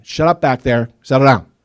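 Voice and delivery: put-on loud stern shout at the kids; dad yelling. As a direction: bark it over your shoulder. Dad yelling from front seat.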